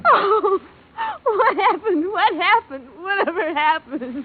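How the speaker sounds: high, loud frightened questions; shocked panic. Acted. Secretary after the blast, frightened and pleading. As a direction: plead in panic, fading toward the end.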